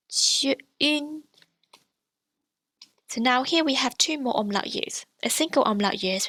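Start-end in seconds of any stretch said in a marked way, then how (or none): 0.1-1.2 jun said high with a French un vowel; Mandarin jun. Shown as a demonstration. Teacher models jun for the umlaut U N. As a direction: give jun a high, level pitch. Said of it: "another example".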